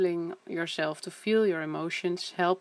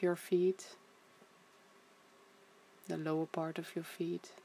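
guided meditation; 4 dB quieter than usual. Acted. Leads a body scan.

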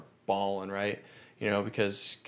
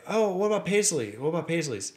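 bright-eyed, high-pitched retold question; eager question; 7 semitones higher than usual. Passenger asking the cabbie about his own last name, eager. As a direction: ask hopefully, pitched well up.

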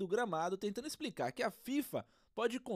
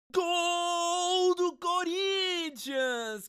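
long, high goal scream; explosive goal commentary; 8 semitones higher, 6 dB louder, much slower than usual. Acted. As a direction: stretch the goal call out. Goal call.

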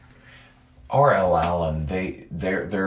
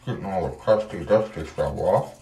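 while brushing teeth. Real, not acted. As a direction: mouth full of toothbrush. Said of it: "And brushing my teeth".

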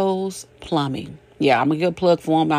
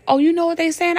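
curious neighbour; 11 semitones higher, 4 dB louder, faster than usual. Retold or imitated. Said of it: "people would say".

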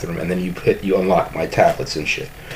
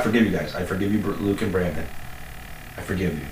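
much softer, hushed forgiving; mock solemn forgiveness; 9 dB quieter, faster than usual. Forgiving Luke and Brandon, hushed mock-solemn. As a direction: forgive them in a hushed, grand tone.

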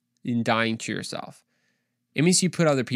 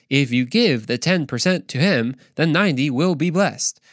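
imitated dubbing translator, measured and emphatic; lilting, deliberate voice-over imitation; translator's voice. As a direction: deliver it like an overdubbed translation. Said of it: "the translation would be like".

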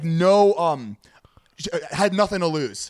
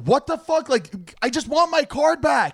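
high, indignant outburst, slowing at the end; retold outrage; 10 semitones higher, slower than usual. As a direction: replay the anger at the bartender. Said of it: "So I was like".

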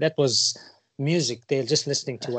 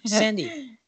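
ordinary delivery

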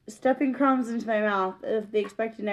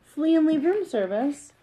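high, rising disbelief; can't believe they'd leave it; bewildered; 6 semitones higher than usual. Acted. Ralph to his mother, bewildered and higher.

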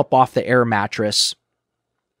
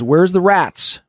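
mean demand; 8 semitones higher, 7 dB louder, slower than usual. Retold or imitated. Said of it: "He was being mean at this point".

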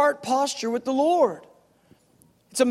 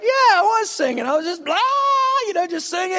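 breezy reply; 8 semitones higher, 4 dB louder, faster than usual. Retold or imitated. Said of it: "I was like".

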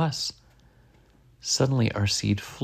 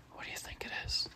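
whispering; 17 dB quieter, much faster than usual. A narrator's reading. Character asks in hushed wonder.

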